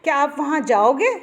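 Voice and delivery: rising questioning end on जाओगे; question tune. Shown as a demonstration. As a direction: ask it, with the pitch climbing on जाओगे. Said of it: "जिस वाक्य में कोई प्रश्न आदि हो".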